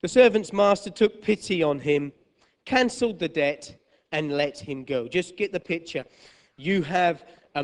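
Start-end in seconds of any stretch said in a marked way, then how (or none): none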